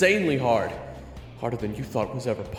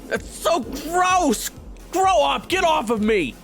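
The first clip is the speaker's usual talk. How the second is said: high, loud revolted shout; outraged high-pitched protest; disgusted yelling; 11 dB louder, slower than usual. Acted. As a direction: yell it while pinned and grossed out. Pinned Raven yells in disgust.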